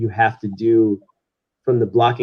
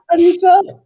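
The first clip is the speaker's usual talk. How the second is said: laughing, high and louder; laughing question; 6 dB louder than usual. Real, not acted. Laughing at being caught by his accent, high laughing voice. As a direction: ask it through a real laugh. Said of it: "is it my accent?".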